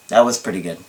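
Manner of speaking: usual speaking voice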